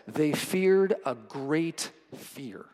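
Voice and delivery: each word drawn out very slowly; reading the original wording with gravity; slow, weighty reading. Shown as a demonstration. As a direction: let the words land one by one. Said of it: "The actual original wording says".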